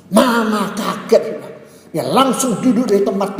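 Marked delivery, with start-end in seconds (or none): none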